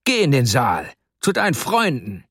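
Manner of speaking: angry order, pitch climbing; contained, high-pitched scolding; angry yell. A narrator's reading. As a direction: send the boy off crossly. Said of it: "schrie er den Kleinen an".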